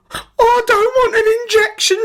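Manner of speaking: extremely high, loud panicked squeal; piercing anxious wail; anxious shriek. A narrator's reading. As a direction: shriek it like a frightened boy. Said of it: "shrieked anxious Andrew".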